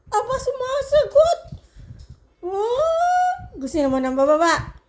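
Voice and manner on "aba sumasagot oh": high, laughing surprise; amused laugh. Real, not acted. Owner laughing when her pet talks back.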